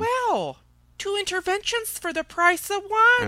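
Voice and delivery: high, smug and gloating. Real, not acted. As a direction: crow over the other's slip. Gloating at his slip.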